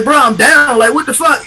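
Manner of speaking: retold protest. Acts out protester being beaten.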